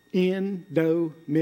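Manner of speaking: "Indomitable" split into five even, detached syllables. Shown as a demonstration. Showing how he learned syllables at school, "Indomitable" in separated beats. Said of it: "You know how I still do syllables?".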